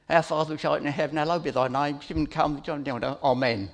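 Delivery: fast, run-together gabble of the prayer; rote recital. Shown as a demonstration. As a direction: rattle it off like a times table. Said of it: "recite it as if it was rote".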